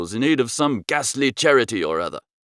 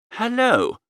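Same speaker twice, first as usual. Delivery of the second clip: sickly grin; 6 semitones higher than usual. A narrator's reading. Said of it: "with a sickly sort of grin".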